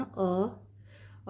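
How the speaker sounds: अ said short, pitched upward; rising अ. Shown as a demonstration. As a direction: let अ go up. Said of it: "अलिफ का sound ऊपर की तरफ जाता है". (ऊपर जाती आवाज़ में अ)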